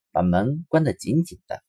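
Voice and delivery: usual speaking voice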